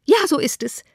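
high-pitched exclamation; calling out. A narrator's reading. Mother breaking in about the ball, high exclamation. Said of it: "rief die Mutter".

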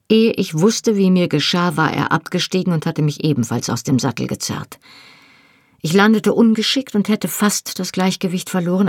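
ordinary delivery, nothing marked

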